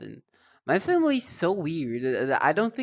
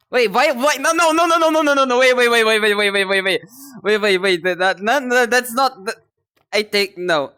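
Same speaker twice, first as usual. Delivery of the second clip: high, loud frantic denial; panicked outburst; 5 semitones higher, 9 dB louder than usual. Real, not acted. Streamer panicking at chat twisting her words, loud and frantic. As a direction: shout to stop the misunderstanding.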